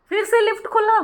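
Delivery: "से" leaps up high in amazement; astonished. Real, not acted. Telling viewers the lift opened again, astonished. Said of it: "तो ये यकीन मानिए".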